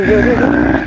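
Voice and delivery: very low, warning ghost voice. Acted. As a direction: warn them like a looming spirit. (गहरी चेतावनी भरी भुतहा आवाज़)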